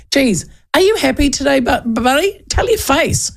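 high mock teasing of a glum worker. Host quoting the cafe owner teasing him, high and mocking. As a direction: needle him cheekily. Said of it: "here's the other thing".

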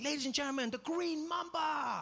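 high MC yell, stretching "the green mamba"; announcer shout. Retold or imitated. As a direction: sell the snake to the crowd. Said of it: "Deng Basan is like".

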